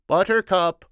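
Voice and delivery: loud, high-pitched father's call. A narrator's reading. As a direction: call your daughter from afar. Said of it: "the father called".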